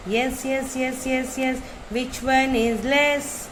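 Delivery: ordinary talk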